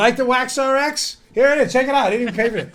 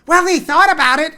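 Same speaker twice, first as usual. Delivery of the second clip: higher-pitched imitation of an opponent's argument; mock objection; 5 semitones higher than usual. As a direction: mimic the other side's protest, pitched up. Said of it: "but the argument will be".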